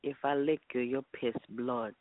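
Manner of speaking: Jamaican accent. Shown as a demonstration. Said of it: "Say it one more time".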